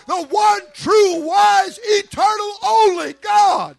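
high, loud, slow shouted declaration; preaching at full force. Acted. Preacher proclaiming the one true God, shouted preaching. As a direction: stack the words up to a peak.

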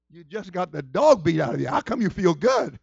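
loud, incredulous retort; mock disbelief. Preacher reacting to a friend's rough massage, mock disbelief. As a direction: challenge them in hammed-up disbelief.

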